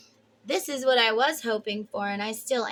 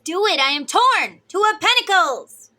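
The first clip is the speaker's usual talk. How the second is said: urgent inner voice; 8 semitones higher, 10 dB louder than usual. Acted. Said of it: "The other part says".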